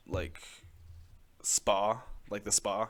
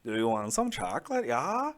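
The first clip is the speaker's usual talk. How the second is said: put-on Norwegian accent; 3 semitones higher, 5 dB louder than usual. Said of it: "they sound like this now".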